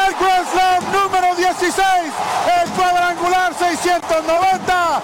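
excited radio play-by-play, shouting; home run call. Real, not acted. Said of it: "Emocionado mi amigo Polo Asensio narrando".

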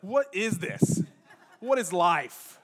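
loud, drawn-out comic despair; hammed-up existential outrage; mock exasperation. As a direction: wail it slowly as if the world ended. Acts someone losing their internet.

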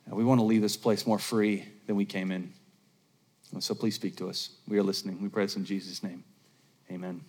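gentle congregational prayer fading to amen; pastor's prayer. Acted. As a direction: pray it, winding down to a hush. Leads closing prayer.

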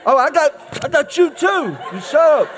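put-on high loud bratty kid taunt; whiny tattling little sibling. Kids fighting in car.

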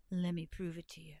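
low, soft snake voice; boastful python. Acted. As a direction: drop low and quiet as the python. The boastful python answers Anansi.

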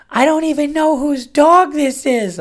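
high, loud mock shriek; imitated woman screaming. Imitating neighbor screaming amid barking dogs, high mock shriek. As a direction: imitate a frantic woman yelling at the top of her voice. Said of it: "I hear screaming from inside".

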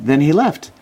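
normal talking voice

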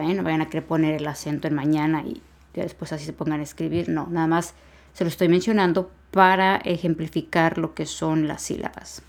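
normal talking voice

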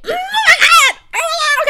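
very high, loud dramatic outburst; dramatic shriek. Real, not acted. Contestant panicking over her final answer, dramatic shriek. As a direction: shriek it in real overdramatic alarm. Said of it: "I'm so dramatic".

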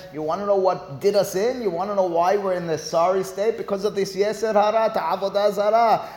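a complaint lifted up to God; crying out to God. Retold or imitated. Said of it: "they're crying out to God".

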